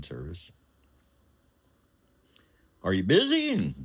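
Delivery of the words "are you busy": high and louder; high boss voice on phone. Retold or imitated. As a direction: pitch it up for the caller.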